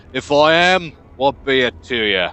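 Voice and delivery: loud, defiant comeback; wary, gruff foreman. Acted. Huge scowling boss answers a nosy stranger, gruff and defiant. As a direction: challenge the stranger's right to ask.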